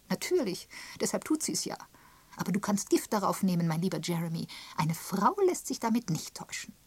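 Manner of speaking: high, emphatic rebuttal; tart Miss Prescott. Acted. Miss Prescott correcting her dear Jeremy, tart. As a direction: put the man firmly in his place.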